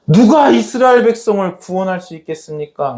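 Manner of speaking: high, pleading question; acted urgent appeal. Elisha asking who can save Israel, acted appeal. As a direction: call out the question in acted desperation.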